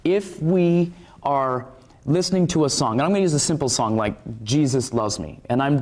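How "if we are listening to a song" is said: ordinary talk, nothing marked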